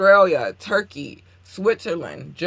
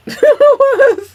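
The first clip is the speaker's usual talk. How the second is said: shrieking burst of laughter; loud high laugh; 14 dB louder than usual. Real, not acted. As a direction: cackle loudly at her own rant. Laughs after going off.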